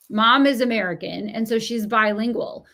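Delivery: ordinary talk, nothing marked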